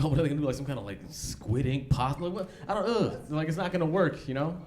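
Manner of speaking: a contestant who can't believe the ingredients; baffled contestant. Retold or imitated.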